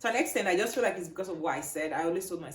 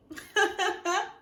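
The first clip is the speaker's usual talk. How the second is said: high, loud real laughter; joyful laugh; 6 dB louder than usual. Real, not acted. Vlogger sharing results after the scare, laughing joyfully. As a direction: burst out laughing in relief.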